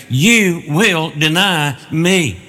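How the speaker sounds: slow, weighty stress on each word of "you will deny me"; emphatic pronouncement. Acted. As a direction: draw out "you will deny me" as a certain verdict. Preacher voices Jesus' solemn prediction.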